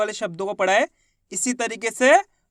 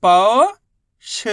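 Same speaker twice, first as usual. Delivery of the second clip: पौष split into पौ, long pause, ष; sound by sound; 3 dB louder, much slower than usual. Shown as a demonstration. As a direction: stretch पौ upward, pause, then ष alone. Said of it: "उच्चारण करके आपस में लाके".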